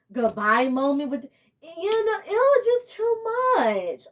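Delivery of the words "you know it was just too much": overwhelmed, pitched way up as if near tears; high-pitched real distress; emotional, upset. Real, not acted. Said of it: "I'm emotional and pissed".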